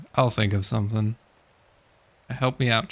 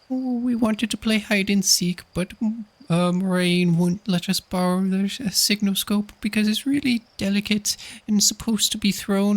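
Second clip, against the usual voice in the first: put-on much higher kid pleading to play; high child voice; 10 semitones higher than usual. Kid asking the astronaut to play hide and seek, high pleading child voice. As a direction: beg sweetly like a small kid wanting a game. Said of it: "I don't know why I can't do the voice".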